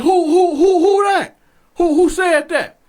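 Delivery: loud, high, stuttering demand; guard's shouted challenge. Acted. Guard demanding who talked back, acted shout. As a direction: shout it like a guard hunting the culprit.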